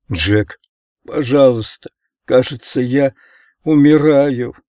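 put-on a boy's helpless cry, fading; weak dying plea. A narrator's reading. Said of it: "Беспомощный голос Ричарда".

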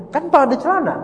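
high-pitched mock objection; acted protest. Man answering a friend's accusation, acted high-pitched protest. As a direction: protest it high, as an obvious excuse. Acts out friend's reply in dispute.

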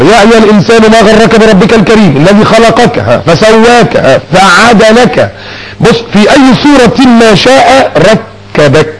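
preacher breaks into Quranic recitation mid-sermon. Acted. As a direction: chant the verse slowly, stretching the words. Reciting Quran verses, Surat al-Infitar.

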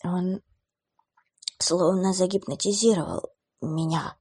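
faint, forced-out words; strained weak voice. Acted. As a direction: squeeze out each word in pain. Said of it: "выдавил парень".

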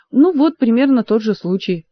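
ordinary delivery

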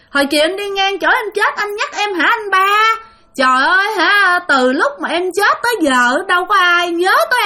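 vengeful ghost Bình greeting the grandfather, shrill and eerie. Acted. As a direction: play the dead woman, high and unsettling. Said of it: "giọng bà thấy ghê lắm, the thé".

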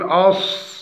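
"أص" with a held emphatic s; precise sad. Shown as a demonstration. Teacher shows the articulation point, emphatic sad in أص. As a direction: hold the heavy hiss ending "أص". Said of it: "فنتحقق من مخرج الصاد".

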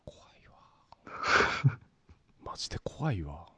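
whispered in fear; whispering. Real, not acted. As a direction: breathe it out, scared. Reaction to cats eating roaches.

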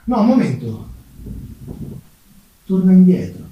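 normal talking voice

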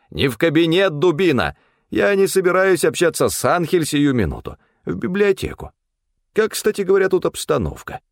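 high-pitched, bossy character voice; imperious Stefan. A narrator's reading. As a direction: order the servant about in a high voice. (высокий повелительный голос)

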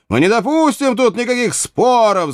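high, defiant crowd protest; unanimous cry. A narrator's reading. Archers refusing all dispute, defiant cry. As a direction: cry out as one roused troop. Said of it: "закричали как один человек".

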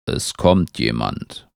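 very low, slow tree; deep tree voice. A narrator's reading. Tree tells beetle someone is coming, deep slow voice. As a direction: speak slowly from deep in the trunk. Tree character warns of a visitor.

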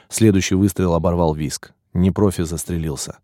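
usual speaking voice

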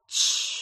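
soft whispered shush; hushing shh. A narrator's reading. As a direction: hush him gently. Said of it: "прошептала она в ответ".

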